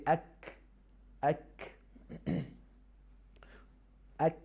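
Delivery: "ak" with a light k at the hard palate, no echo; kaf demo. Shown as a demonstration. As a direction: say "ak" from the front of the mouth. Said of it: "how do you pronounce the kaf".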